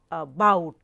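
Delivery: "about" with a short A and a loud, falling BOUT; stress on second syllable. Shown as a demonstration. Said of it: "So, the correct speaking or pronunciation".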